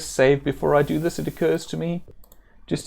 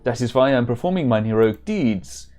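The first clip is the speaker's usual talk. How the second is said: put-on German-accented warrior; hammed-up German accent. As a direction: play it as an earnest German hero. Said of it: "supposed to be a German accent".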